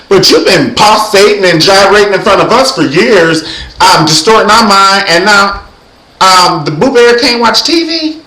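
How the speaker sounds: high, loud comic telling-off; mock scolding. Calling out [name] over banning TV, mock scolding.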